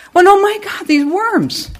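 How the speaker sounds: high "oh my God" falling onto these worms; shocked at all the worms; surprised dismay. Retold or imitated. Preacher recalls finding worms everywhere, surprised dismay.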